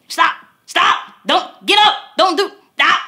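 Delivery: high, loud frantic mom yell; frustrated yelling. Retold or imitated. Mom yelling at her toddler on the party floor, frantic. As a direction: shout it at a rolling toddler. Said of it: "I was frustrated and mad".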